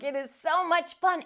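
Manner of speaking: ordinary delivery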